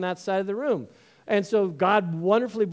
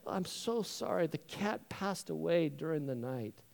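soft, gentle sympathy; tactful condolence; 10 dB quieter than usual. Acted. Brother models tactful news of cat's death, softly. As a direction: break the death gently and quietly. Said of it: "you gotta be more tactful".

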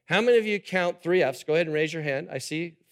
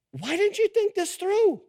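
much higher, sneering whine; mock complaint; 13 semitones higher than usual. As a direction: pitch it up like a petulant complainer. Mocking the people's complaint to Moses.

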